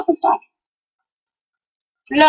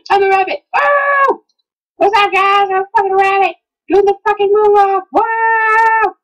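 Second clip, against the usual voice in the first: much higher, hyped shouting with whoops; giddy excitement; 8 semitones higher, 3 dB louder than usual. Real, not acted. Thrilled to be a rabbit, whooping high and loud. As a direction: bounce off the walls with joy.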